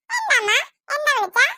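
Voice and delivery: put-on sweet little girl called by mom. Performer voices the little girl.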